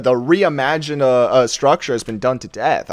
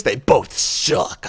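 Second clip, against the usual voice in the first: usual speaking voice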